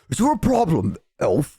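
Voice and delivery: imitating a menacing orc, dropping on the last word; orc bully. Orc to an elf at the bar, menacing. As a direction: confront them, then drop the pitch. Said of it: "And you're like".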